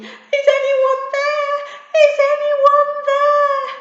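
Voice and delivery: very high, loud, slow desperate call; panicked shouting. Acted. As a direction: call out lost in the snow. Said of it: "Maisie started shouting".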